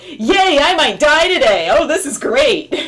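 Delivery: loud, high fake delight; mock-cheer it loudly, slowing at the end; sarcastic cheer. Sarcastic cheer about snow driving.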